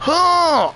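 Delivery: high, loud "oh"; high yelp of sudden alarm; startled cry. Real, not acted. Racer accidentally knocking someone out, high startled cry.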